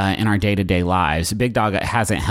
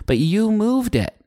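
rising indignant pitch; 12 semitones higher than usual. Real, not acted. Defending Roomba, pitch rising.

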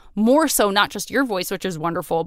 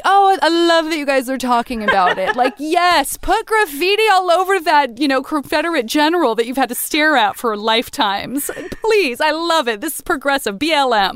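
higher, louder, over-the-top cheering; mock gushing; 6 semitones higher, 3 dB louder than usual. Distant onlooker cheering on the monument debate, mock gushing. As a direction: gush like an overeager ally. Parodies her own gushing from afar.

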